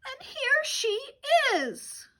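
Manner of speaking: little bear cries out at finding her; Baby Bear spotting Goldilocks in his bed, excited; Baby Bear discovery. Acted.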